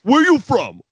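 loud, high mock challenge; Taz. Threatened commentator keeps asking his rival's origin, imitated loud high blurt. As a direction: blurt it out in exaggerated agitation. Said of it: "watching Taz freak out".

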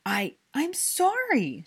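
high, loud, stammered apology; shriek. A narrator's reading. Hermione apologizing to angry Draco, shrieking. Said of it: "she shrieked".

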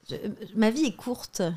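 ordinary talk, nothing marked

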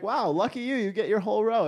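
high, loud mock admiration; envious passenger. Passengers eyeing his empty row, mock-envious exclamation.

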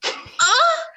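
sudden high startled ah; surprised. Acted. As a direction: jump up in surprise. Attendant reacts to request.

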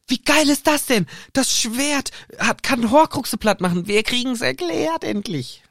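high, thrilled gushing; acted wild excitement. Hermine and Harry going wild over the sword, acted excitement. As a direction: marvel at it, stretching the words. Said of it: "Hermine und Harry rasten vollkommen aus".

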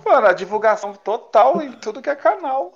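high, loud hype; excited. Real, not acted. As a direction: pump him up with big energy. Hyping up a friend's album.

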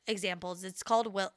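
usual speaking voice

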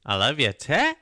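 broad mock Australian accent. Shown as a demonstration. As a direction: put on an Australian twang. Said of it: "That's my Australian".

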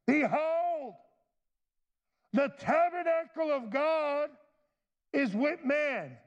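high, proclaiming voice from the throne, drawn out slowly at the end; voice from heaven. Acted. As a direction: announce it like a heavenly herald. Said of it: "watch what this loud voice says".

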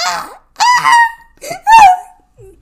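high, loud laughing squeal; a squeal of flustered joy; excited squeal. Real, not acted. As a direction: shriek with giddy delight. Overwhelmed by her own compliments.

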